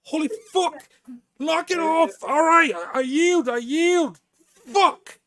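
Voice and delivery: pained shout. Retold or imitated. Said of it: "and he's like".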